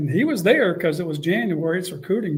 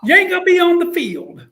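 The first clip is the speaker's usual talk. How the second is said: much higher, louder blunt coach; Spurrier voice; 11 semitones higher, 7 dB louder than usual. Retold or imitated. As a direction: lay it down high and loud. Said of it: "He says".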